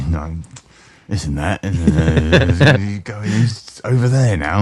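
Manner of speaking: imitated drunk, words sliding into a mumble; incoherent drunken grumbling. Retelling a drunk millionaire's pub stories, imitated incoherent grumbling. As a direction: slur and ramble like a pissed regular. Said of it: "more and more incoherent".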